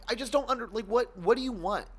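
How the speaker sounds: normal talking voice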